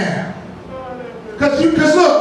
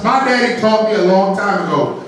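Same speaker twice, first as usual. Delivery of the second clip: ordinary talk, nothing marked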